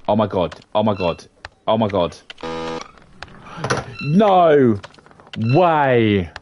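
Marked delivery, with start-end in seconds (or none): none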